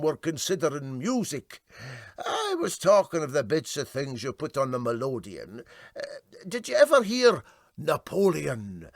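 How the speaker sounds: a hint of a gruff Clyde sailor; lightly voiced Highland seaman; Scots crewman, lightly voiced. A narrator's reading. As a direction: argue it out in broad Scots. Characters argue, voiced.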